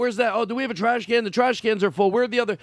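high-pitched mock flurry of demands; fussing party guests. Guests pestering the party host for things, high mock fussing.

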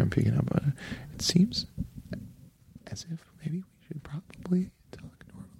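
barely-there whisper after being told off for volume; exaggerated whisper. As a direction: whisper it haltingly, as if afraid to peak the meter. Told too loud, drops to whisper.

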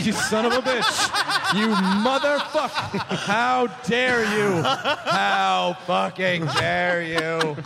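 mock outrage, laughing; slow and indignant, through laughter.

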